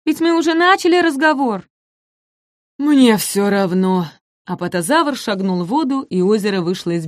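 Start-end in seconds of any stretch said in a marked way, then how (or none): 0.0-1.7 high, polite insistence; polite little dinosaur. Acted. Ком вежливо возражает апатозавру. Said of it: "очень вежливо". 2.8-4.1 slow, indifferent drone; apathetic giant. Acted. Апатозавр равнодушно, медленно.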